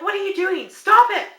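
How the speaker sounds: high, loud scolding shout; mother yelling at her eight-year-old. Retold or imitated. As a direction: yell at the child to stop. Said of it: "And I'm like".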